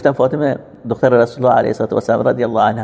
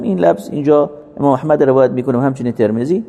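ordinary talk, nothing marked

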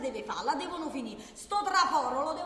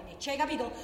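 ordinary delivery

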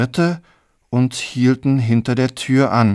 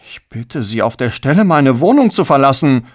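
controlled demand building to loud; rising anger; 3 semitones higher, 5 dB louder than usual. Acted. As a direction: order them out, anger mounting. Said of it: "das Blut in den Kopf schoss". (mit wachsender Wut auffordern)

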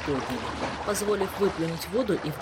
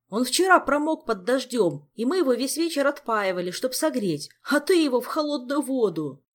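high, loud, fretful complaint; anxious protest; 8 semitones higher, 5 dB louder than usual. A narrator's reading. Jacques keeps protesting in alarm.

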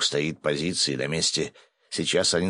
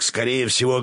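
raised, higher call; mild yell; 6 semitones higher than usual. A narrator's reading. Assistant names the likely attacker, mild yell. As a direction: call the guess out urgently. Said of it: "заорал мой помощник".

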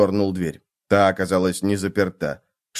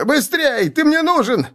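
very high, urgent squeal; shriek. A narrator's reading. Terrified captive calling his comrade to come, shrill shriek. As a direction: squeal urgently for him to hurry. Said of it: "взвизгнул человек".

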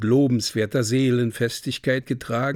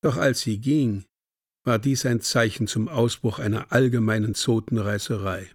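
usual speaking voice

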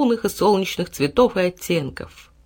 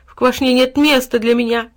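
mildly tearful, raised voice; tearful wail; 5 semitones higher, 5 dB louder than usual. A narrator's reading. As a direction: lament loudly, as if in tears. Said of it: "весь в слезах запричитал". (плаксивое причитание)

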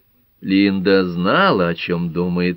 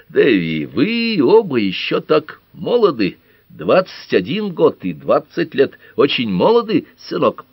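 slow, halting, higher-pitched appeal; searching for words; 8 semitones higher, 5 dB louder, slower than usual. A narrator's reading. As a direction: pick the words out with effort. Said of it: "с трудом подыскивает слова". (медленно, с трудом подбирая слова)

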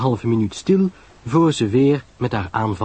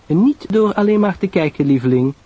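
high, sugary correction; sweetly; 9 semitones higher, 3 dB louder than usual. A narrator's reading. Sarah teases her friend about her nosiness, sweetly. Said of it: "zei Sarah liefjes".

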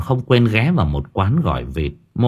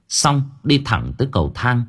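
usual speaking voice

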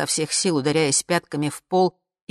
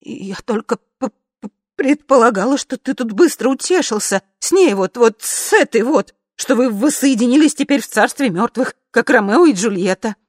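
high, loud, stumbling protest; rattled, high-pitched self-defence; shaken stammering; 7 semitones higher, 7 dB louder than usual. A narrator's reading. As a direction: stumble over the words in agitation. Wife stammers while shaking.

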